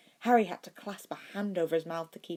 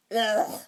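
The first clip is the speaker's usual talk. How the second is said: disgusted ugh; 6 semitones higher, 7 dB louder than usual. Acted. Disgust after drinking champagne.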